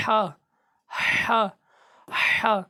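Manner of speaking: ہا puffed like out of breath; breathless ہا demo; breathy throat. Shown as a demonstration. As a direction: pant ہا from the mid throat. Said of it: "ہا کی آواز بالکل اس طرح آئے گی".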